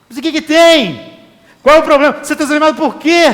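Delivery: preacher pressing the point hard. Acted. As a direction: pastor confronts the discouraged pastor, loud and forceful. Repeated challenge, loud second.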